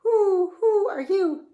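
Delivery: high, loud hooting owl. A narrator's reading. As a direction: hoot it slowly like an owl. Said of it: "the owls went".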